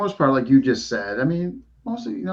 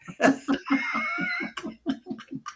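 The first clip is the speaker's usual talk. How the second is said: breaks into a high-pitched laugh; a squeaky burst of laughter; 5 dB quieter than usual. Real, not acted. Laughing at own joke.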